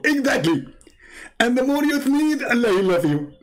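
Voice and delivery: very high cartoon caller; Zakir Naik. Fake Zakir Naik answers the host's sneeze question, high cartoon voice. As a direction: agree gleefully in a high put-on voice. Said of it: "Somebody is just joking with you".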